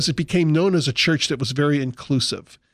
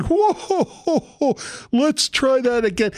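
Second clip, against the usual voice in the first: very high laughing showman voice; hammed-up emcee; 9 semitones higher than usual. Preacher mimics an emcee who demands the crowd answer again, high and hammy. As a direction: chuckle like a cheesy MC. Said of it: "he was still planning on saying".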